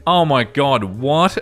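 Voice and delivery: ordinary delivery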